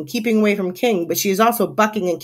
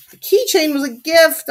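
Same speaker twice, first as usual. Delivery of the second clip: higher, protesting wail; distressed cry; 8 semitones higher, 4 dB louder than usual. A narrator's reading. Narrator protests to goat eating keychain, distressed cry. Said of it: "I cry".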